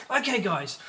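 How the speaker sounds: ordinary delivery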